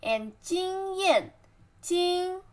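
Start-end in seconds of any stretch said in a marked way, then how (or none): 1.8-2.4 经 high and level; flat tone. Shown as a demonstration. Teacher breaking down experience, level tone on 经. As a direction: hold 经 on one steady high pitch.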